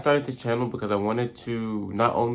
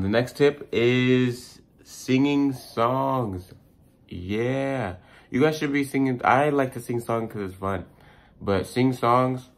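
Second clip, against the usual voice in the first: excited; 5 semitones higher, 4 dB louder, slower than usual. Real, not acted. Excited high announcement of tip.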